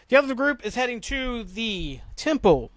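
grand stretched stress on "temple"; dramatic emphasis. Real, not acted. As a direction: hold "the", then stretch "temple" and let it fall. Announces long-awaited temple with mock grandeur.

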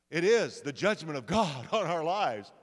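loud mock doom, half laughing; hammed-up dread. Brothers finding the gold back in their sacks, hammed-up dread. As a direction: play the panicked brothers for laughs.

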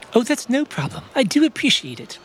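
put-on chirpy old gentleman; professor voice. Professor answers agent.

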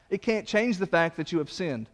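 ordinary talk

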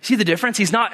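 usual speaking voice

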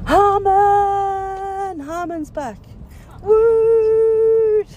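high, loud cheering shout; excited cheer. Real, not acted. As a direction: whoop it up. Cheering himself on air.

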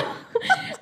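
high burst of a laugh; quick high giggle. Real, not acted. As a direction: laugh off the idea. Laughs at the suggestion.

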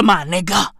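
usual speaking voice

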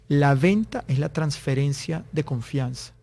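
slow and deliberate, each phrase set apart; slow, weighty maxim. Retold or imitated. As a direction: deliver it slowly as a key quote. Quotes the sales guru's key maxim.

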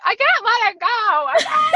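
high, loud, half-laughing cry of frustration; exasperated outburst. Retold or imitated. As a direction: blow up in mock exasperation, cracking into laughter. Venting about simple husbands.

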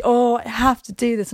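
a lightly voiced Glenda, reluctant and put upon; hammed-up reluctant whine; mock reluctant sigh. Reviewer mimics Glenda reluctantly selling herself, mock reluctant. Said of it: "this really reluctant thing that she".

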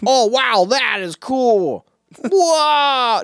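high, gushing commentator; mock amazed reaction; fake excited. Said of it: "reacting to it as well".

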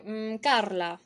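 usual speaking voice

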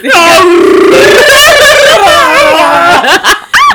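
high shrieking laughter; loud shrieking laugh. Real, not acted. After friend's joke.

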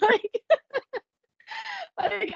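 high-pitched laugh through the words. Real, not acted. Laughing while admitting she was an angry person. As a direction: giggle it out, high.

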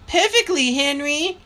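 high-pitched Mama; Mama's voice. A narrator's reading. Mother answering Papa's question about her health, high-pitched. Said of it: "said Mama".

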